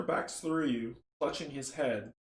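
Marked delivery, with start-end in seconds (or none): none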